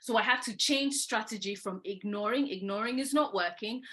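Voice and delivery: ordinary delivery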